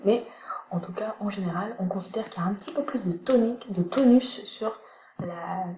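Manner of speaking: ordinary delivery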